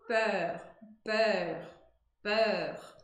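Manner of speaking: "peur" with an open rounded E, lips pushed out; French peur. Shown as a demonstration. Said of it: "letra E de égua fazendo um bico na boca". ("peur" com E aberto e bico na boca)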